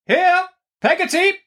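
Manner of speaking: very high, imperious call. A narrator's reading. Aunt calls servant through parlour door, imperious cry. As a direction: summon the servant in a piercingly high voice. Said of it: "cried Miss Betsy".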